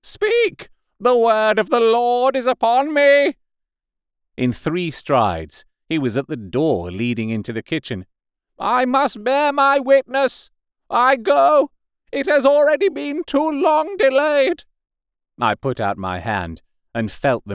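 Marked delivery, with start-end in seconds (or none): 0.0-3.4 very high, loud prophetic cry; fanatical shout. A narrator's reading. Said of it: "shouted the curate at the top of his voice". 8.6-14.6 fanatical shout. A narrator's reading. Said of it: "shouted the curate at the top of his voice".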